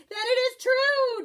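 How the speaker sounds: high and loud proclamation; excited declaration. A narrator's reading. Don Diego proclaims the stranger is real, excited and loud. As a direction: announce it with glee. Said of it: "declared Don Diego".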